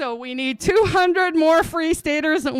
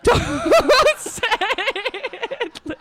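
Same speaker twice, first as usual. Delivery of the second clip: higher, laughing through don't say Hitler; laughing plea; 8 semitones higher, slower than usual. Retold or imitated. Retelling her inner panic to the audience, laughing. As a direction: crack up while repeating the plea. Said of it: "in my head, I'm just going".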